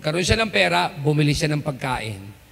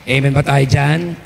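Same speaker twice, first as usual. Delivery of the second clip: preaching call; 6 semitones lower, 3 dB louder than usual. Acted.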